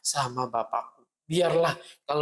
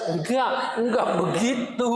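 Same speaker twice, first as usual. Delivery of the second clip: laughing denial; 7 semitones higher than usual. Acted. Father corrects with a laugh.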